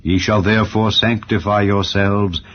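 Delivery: usual speaking voice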